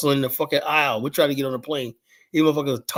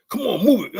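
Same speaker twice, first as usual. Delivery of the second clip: mock yell; 6 semitones higher, 4 dB louder than usual. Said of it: "yelling and whatnot".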